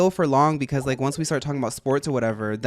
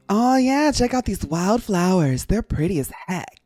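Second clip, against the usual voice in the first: chipper cartoon critter voice, higher; character voice; 4 semitones higher than usual. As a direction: voice a laid-back game creature. Reading Scyther's game dialogue.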